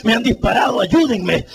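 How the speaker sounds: de ruego a grito cada vez más agudo; súplica creciente. Retold or imitated. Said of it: "Yo decía". (herido ruega a los que se van que lo saquen, desesperado)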